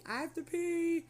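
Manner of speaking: put-on high, loud old-man holler; imitated yelling. Older patient tells nurse he needs to pee, imitated yelling. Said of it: "He kept yelling".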